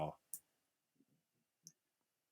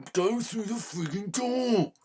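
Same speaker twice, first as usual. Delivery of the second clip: much higher, louder and slow, every word hammered; furious yell; 13 semitones higher, 8 dB louder, slower than usual. Real, not acted. Gamer screaming at the character stuck at the door, furious shout. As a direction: explode at the character who won't move.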